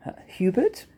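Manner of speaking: high, questioning little voice; tentative suggestion. A narrator's reading. Fluffy guesses the rhinoceros's name, high and tentative. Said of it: "suggested Fluffy".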